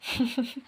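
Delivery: light character chuckle; acted laugh. A narrator's reading. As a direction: give a short, quiet acted laugh. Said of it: "Rukmini Bai ನಕ್ಕರು".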